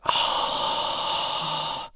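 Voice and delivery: long voiceless "ohh" on breath; mock crowd marvelling in one breath; crowd's awed sigh. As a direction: exhale a hushed, drawn-out ooh. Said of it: "and they go".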